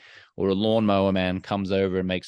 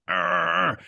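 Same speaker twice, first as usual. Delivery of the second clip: imitated engine roar; revving engine noise; 10 semitones higher than usual. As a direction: growl it like a revving motorbike.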